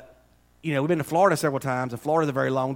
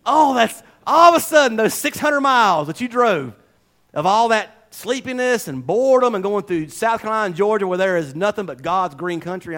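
excited preaching; 5 semitones higher, 7 dB louder than usual. Acted.

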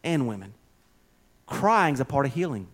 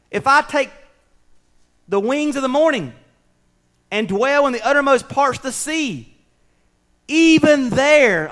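higher, ringing Psalm reading; impassioned scripture reading; 7 semitones higher, 5 dB louder than usual. Acted. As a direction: proclaim the verse with mounting force. Reads Psalm aloud.

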